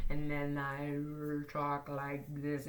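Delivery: very slow, drawn-out talk. Shown as a demonstration. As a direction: show what slowed-down talk sounds like. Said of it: "you can always slow this down".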